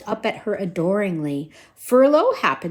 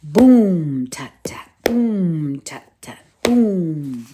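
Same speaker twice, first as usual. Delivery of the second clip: drum imitation; 4 semitones lower, 4 dB louder, much slower than usual.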